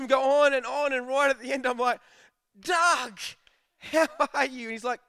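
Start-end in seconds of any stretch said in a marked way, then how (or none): 2.6-4.6 loud, higher delighted greeting; hammed-up eureka hello; sudden recognition. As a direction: act like it just clicked. Finally recognising caller.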